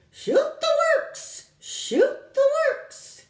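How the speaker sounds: very high, slow, loud bird shriek. Acted. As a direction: shriek it with wild glee. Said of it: "shrieking ferociously".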